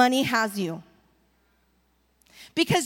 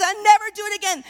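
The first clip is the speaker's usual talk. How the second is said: mock tearful wail; 6 semitones higher, 6 dB louder, much faster than usual. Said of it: "and cries and says".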